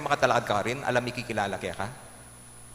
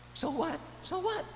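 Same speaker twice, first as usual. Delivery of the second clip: very high, soft falsetto; high-pitched playful comeback; mock retort; 6 dB quieter, slower than usual. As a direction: toss it back in a tiny high voice. Acted retort to the excuses.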